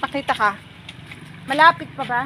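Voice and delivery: ordinary delivery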